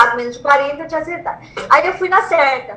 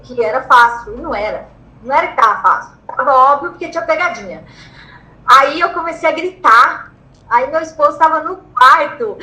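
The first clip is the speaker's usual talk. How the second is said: ordinary talk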